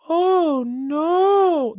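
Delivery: a hint of the movie's anguished cry; Planet of the Apes meme. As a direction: ham up the doomed meme line. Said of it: "that meme from Planet of the Apes where the guy's like".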